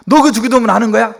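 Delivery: loud, high-pitched indignant challenge; imitated angry scolding. Pastor rebuking a relative for rote prayer, angry. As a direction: tell them off as an outraged uncle would. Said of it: "뭐 이렇게 막 화를 내시는 거죠".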